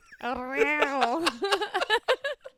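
high mewing cat noise; kitten voice. Pretend. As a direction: meow like a little kitten. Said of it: "It's my best cat sound".

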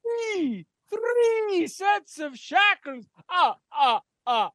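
high, slow counting with evil laugh; Count von Count voice. Acted. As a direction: count it out like a gleeful vampire.

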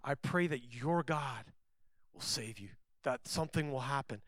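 the king hushes his wish for Daniel; hushed hope. Retold or imitated. Scene: king to Daniel before the lions' den, quiet hopeful plea. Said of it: "he did say this".

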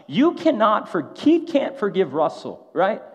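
high, loud protest; imitating an affronted friend; indignant objection. Acts out Brandon's outraged protest.

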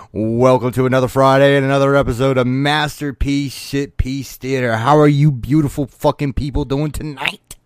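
hyped show host welcome; loud announcer. Acted. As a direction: pump up the crowd. Host's loud show opening.

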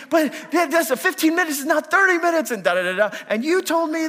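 high, loud indignant retort; husband arguing with his wife, a high heated retort; re-enacted argument. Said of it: "in this, like, argument".

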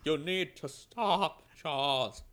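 hammed-up young Magneto. Shown as a demonstration. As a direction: warn an old friend, villain style. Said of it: "That's a good young Magneto".

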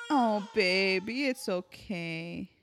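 slow, soft cooing reassurance; gently calm the little one down; soothing a pet. Real, not acted. Soothing a pet mid-recording.

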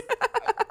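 high-pitched burst of laughter. Real, not acted. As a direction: break into a squealing laugh. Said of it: "burst of laughter".

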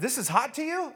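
high-pitched incredulous question; puzzled hosts. Acted. Hosts question the suffering kid.

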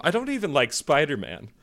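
ordinary talk

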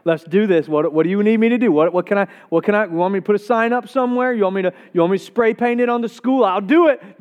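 eager volunteer, words tumbling out fast; rushed, stumbling eagerness rising to an emphatic offer; getting excited. Acted. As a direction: imitate an over-keen helper, building in pitch and pace. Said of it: "Maybe you're getting excited".

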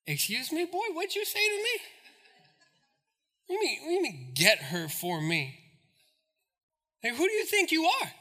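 quiet, incredulous stern father; a father who can't believe his ears; indignant daddy. Retold or imitated. Father scolding his son for demanding a wife, soft and incredulous. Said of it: "if I'm Samson's daddy".

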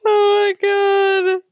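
high, loud gasp of shock; gasping oh my God. Real, not acted. Reacting to her friend's joke, high and loud real gasp. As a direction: gasp it out, truly stunned.

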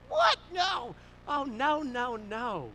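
high, loud protest easing down; emphatic disbelief. Retold or imitated. Dad refusing the pastor's resignation, startled emphatic protest. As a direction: burst out, then settle into reassurance. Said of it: "my dad's like".

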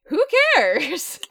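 high, loud, exasperated shrug of a quote; mock dismissive outburst. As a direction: throw it out as a big comic dismissal. Acts out own reaction to film.

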